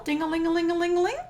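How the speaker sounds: quick, high sing-song ding-a-ling; playful bell imitation, fast and high; bell sound. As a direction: ring the bell playfully with your voice. Ring the bell request.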